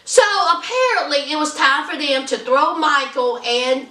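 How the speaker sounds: ordinary talk, nothing marked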